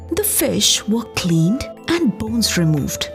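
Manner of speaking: ordinary delivery, nothing marked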